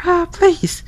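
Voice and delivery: high put-on girly voice; girl voice. Yama's friend calling through the door in a high girl voice. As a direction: pitch way up into a girl's voice. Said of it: "my girl voice".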